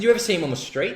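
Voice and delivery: shocked question. Retold or imitated. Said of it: "I said".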